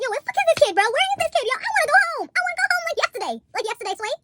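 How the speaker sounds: high, loud whiny impatience; impatient friend. Retold or imitated. Impatient friend whining to be taken home. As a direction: whine like you've waited all day.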